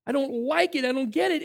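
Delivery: ordinary delivery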